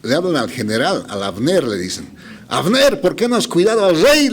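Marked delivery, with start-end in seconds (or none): 2.5-4.3 higher, mock call across the camp. David's men calling out to Abner from far off, a mild mock shout. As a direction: call out to the sleeping general from afar, half-acted. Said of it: "empiezan a gritar".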